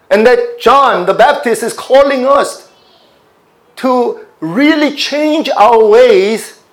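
high, loud, driving sermon voice; fervent preaching. Acted. Preacher urging the congregation toward repentance, loud and fervent. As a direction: preach it out at full force to the congregation.

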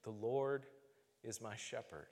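softly falling prayer on "The Lord is my shepherd"; whispered prayer. Retold or imitated. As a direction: pray it quietly to yourself like a mantra. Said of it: "I would pray those words".

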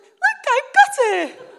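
high, loud, slow imitation of a girl; triumphant child. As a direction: show off proudly as a child. Daughter triumphantly presents the dog.

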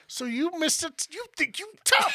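high, loud mocking taunt. Sister taunting her drunk brother, high and mocking. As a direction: sneer it in a squeaky mocking voice. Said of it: "I was like".

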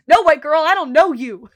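loud, high, hammed-up brush-off; mock Carl snub. Carl blowing off his sister Debbie, loud mock brush-off. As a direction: bark it like a dismissive kid, half-joking.

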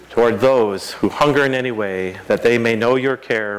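ordinary talk

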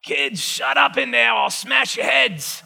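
mock New York mobster shouting at the kids; hammed-up New York gangster yell; New York Godfather voice. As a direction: bark it like a Brooklyn tough guy. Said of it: "I always sound like I'm from New York".

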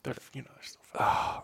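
unvoiced whisper aside; whispered under the breath. Real, not acted. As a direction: hush it like a secret.

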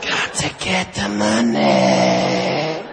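deep lazy dude-in-a-suit voice; low slow banker voice. Acted. Smug banker brushing off the public, low and slow.

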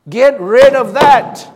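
loud, high, slow command; preacher's emphatic shout; forceful command. Real, not acted. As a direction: belt it out with each word hit hard.

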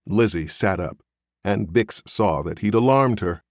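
ordinary delivery

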